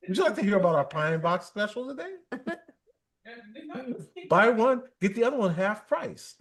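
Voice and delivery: mock sales pitch. Pranking scam callers with a coffin sales pitch, fast and laughing. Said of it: "I'll say".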